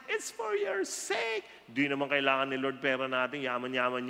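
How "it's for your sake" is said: high emphasis. Acted. Preacher stresses point.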